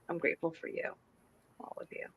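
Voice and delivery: hushed heartfelt thanks; soft, sincere gratitude. Real, not acted. Co-host thanking her friends on the show, softly sincere. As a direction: let it drop softly, meant sincerely.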